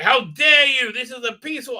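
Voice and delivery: mock indignant old lady. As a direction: play the affronted old lady. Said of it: "and she's like".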